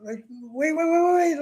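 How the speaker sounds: high-pitched mock panicked pleading; a touch of acted panic; retold frozen fear. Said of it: "I'm just frozen".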